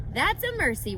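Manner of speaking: a small pig glad of the news; Wilbur's high piglet voice; Wilbur relieved. Acted. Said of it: "replied Wilbur".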